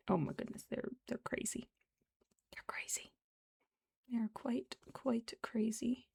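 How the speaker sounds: whisper. Real, not acted. Whispering about crazy robins.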